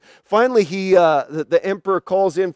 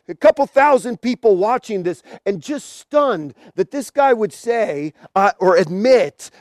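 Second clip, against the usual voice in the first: usual speaking voice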